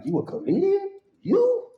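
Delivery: high, disbelieving squeak of a question; mock shock that he's funny; old inmates doubting he's a comedian, high and disbelieving; incredulous. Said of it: "they be like".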